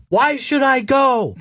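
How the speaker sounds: high, drawn-out mock scream of protest; retold screaming back. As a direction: imitate him screaming back, slow and high. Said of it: "he's screaming back to us".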